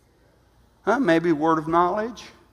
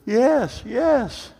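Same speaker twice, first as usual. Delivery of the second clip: high tearful yes, yes; mock sobbing yes, choked up; retold tearful yes; crying yes; 7 semitones higher than usual. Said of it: "he starts crying and said".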